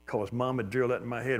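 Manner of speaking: ordinary delivery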